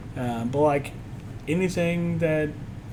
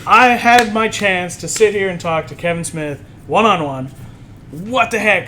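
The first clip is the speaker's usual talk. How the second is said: louder, exasperated retold complaint; frustrated disbelief; 3 semitones higher, 7 dB louder than usual. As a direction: replay your frustration at a blown chance. Retells his frustration at missed chance.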